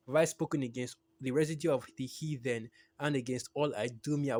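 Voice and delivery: solemn preacher's reading of scripture. Acted. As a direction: proclaim the scripture with weight. Reads scripture in sermon.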